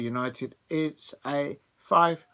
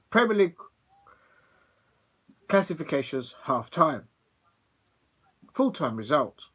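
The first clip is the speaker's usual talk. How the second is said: put-on old pools commentator; 4 dB louder than usual. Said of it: "I'm gonna try and do it in his accent".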